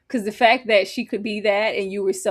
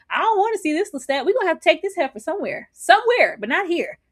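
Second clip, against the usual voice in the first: exasperated; 6 semitones higher, faster than usual. Real, not acted. Exasperated rant.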